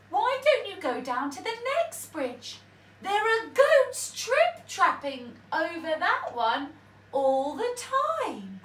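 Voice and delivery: a mouse sweetly sending the troll elsewhere; crafty mouse. A narrator's reading. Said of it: "said the mouse".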